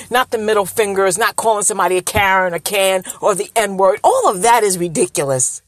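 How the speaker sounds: ordinary talk, nothing marked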